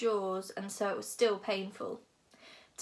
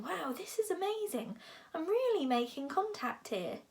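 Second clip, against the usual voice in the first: high, hushed wonder; amazed delight; 7 semitones higher than usual. A narrator's reading. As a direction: marvel privately, thrilled by the breakthrough. Hiccup's delighted thought in story.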